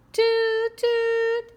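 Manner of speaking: very high, loud, held horn toots; train horn toot. A narrator's reading. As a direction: honk it out like a train whistle.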